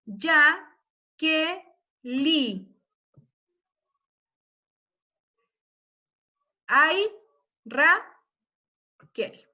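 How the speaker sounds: "be, ya, que, li, ay, ra, kel" counted out with pauses; syllable by syllable. Shown as a demonstration. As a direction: tap out each metric syllable. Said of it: "esta recibe el acento B". (separando sílaba por sílaba)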